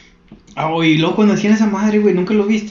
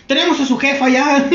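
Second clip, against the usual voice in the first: mock extortionist threat; 9 semitones higher than usual. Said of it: "Ya cambiaba de estrategia el vato".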